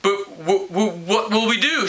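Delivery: a hint of tearful stammer; wobbly upset. A narrator's reading. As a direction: lip wobbling, stuttering out the worry. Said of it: "lip stuck out and wobbled".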